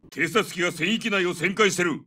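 ordinary delivery

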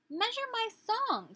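high, slow bossy bird voice; demanding nightingale. Acted. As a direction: order it grandly from up high. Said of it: "said the nightingale".

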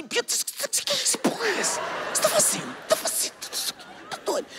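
high, strained panicked hiss at the table; a panicked half-whisper, voice cracking high; desperate. Retold or imitated. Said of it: "E eu desesperado". (sussurro desesperado e agudo para a noiva)

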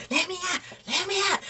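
high, fast mock panic, Let me out; imitating a panicking drunk; panicked drunk plea. Imitating the drunk brother-in-law begging to get out, high and frantic. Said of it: "He like".

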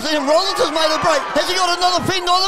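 high, loud shouted rugby call; excited play-by-play. Real, not acted. Commentator calls Liam Rollins making a break, shouting excitedly. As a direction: race upward into a full-voiced commentary shout. Live try-scoring play-by-play.

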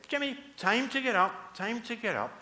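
gently insistent wake-up call; mum waking him. Acted. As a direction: rouse a sleeping child.

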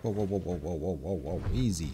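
low, soft repeated whoas; soft, low-pitched soothing; calming an animal. Real, not acted. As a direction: settle an agitated beast, keeping the voice down. Soothing the angry creature.

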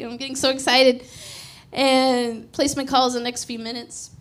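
ordinary talk, nothing marked

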